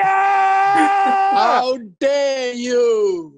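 high, loud, very slow wail; hammed-up melodramatic shout; mock outrage. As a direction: drag out every word in fake fury. Mock screaming match.

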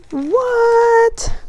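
high, loud squeak of astonishment; shocked. Real, not acted. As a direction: squeal the word in amazed surprise. Surprise gift found.

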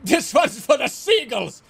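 hammy chef announcing his pizza toss. Retold or imitated. Chef flinging pizza to the seagulls, slow grand declaration. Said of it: "He's like".

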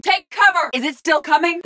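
high-pitched warning cry; urgent combat shout. Acted. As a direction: call out in alarm under fire. Combat callout under attack.

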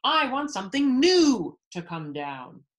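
loud, high royal demand; bellowing. A narrator's reading. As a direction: belt out the demand, leaning on "new". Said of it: "bellowed the king".

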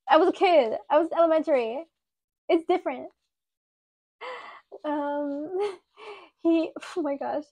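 ordinary talk

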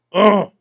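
mock cry of pain as a hook hits; pained grunt. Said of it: "Buster turns around to me and goes".

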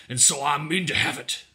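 forceful, insistent grumble; complaining. A narrator's reading. Dow tugging at Ellen's arm, insistent complaint. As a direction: insist while pulling hard. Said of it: "Dow complained".

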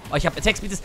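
ordinary delivery

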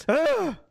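short, high, loud scream of frustration; sharp "ah" yell of rage; frustrated scream. Real, not acted. Frustrated at being killed.

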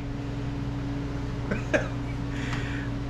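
breathy unvoiced laugh of relief; relieved laugh. Real, not acted. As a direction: laugh it off, pleased. Laughs after fans start.

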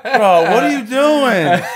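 high, loud, drawn-out incredulous imitation; laughing mock protest. Acting out friends' startled protest.

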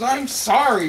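high, loud sorry; flustered apology. Acted. Waiter apologizing to the raging guest, flustered. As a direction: back off apologizing.

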